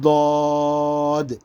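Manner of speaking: ضاد thick and rounded, vowel held long on one note; heavy, full ضاد. Shown as a demonstration. Teacher demonstrating the letter, ضاد heavy and stretched. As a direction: press tongue side to molars for ضاد. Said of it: "موٹا پڑھا جانے والا حرف".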